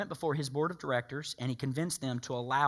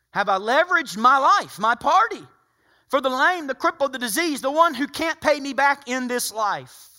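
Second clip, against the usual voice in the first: much louder and higher, hammering each item; pressing loud question; 10 semitones higher, 10 dB louder than usual. Acted. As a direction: drive the question home to the crowd. Same question repeated, now pressed hard.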